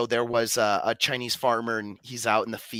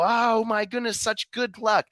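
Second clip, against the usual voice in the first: high-pitched, gushing excitement; gushing neighbors; 10 semitones higher than usual. Retold or imitated. Neighbors cooing over the farmer's luck, high and gushing. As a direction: squeal it like a thrilled busybody. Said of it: "And his neighbors go".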